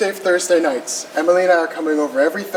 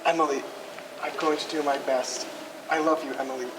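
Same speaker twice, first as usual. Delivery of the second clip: tender vow; 4 semitones lower, 11 dB quieter, faster than usual. Acted. Groom vows to the bride.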